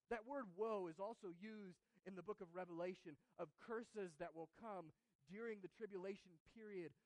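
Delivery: normal talking voice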